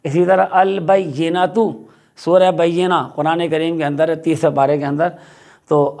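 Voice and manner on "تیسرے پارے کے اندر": ordinary talk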